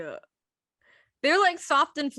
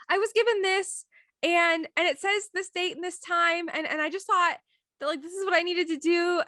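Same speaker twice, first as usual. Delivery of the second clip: raised pitch, pleading your case; high-pitched retold self-justification; earnest explanation; 8 semitones higher than usual. Student's guest explains herself to the parent, earnest and high.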